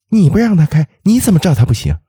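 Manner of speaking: high, sharp retort; talking back. A narrator's reading. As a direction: snap back at the driver. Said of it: "竟然开口顶撞司机说".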